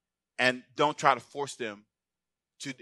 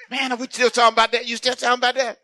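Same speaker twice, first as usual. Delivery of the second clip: mock exasperation; 10 semitones higher, 5 dB louder than usual. Acting out friend sick of old talk.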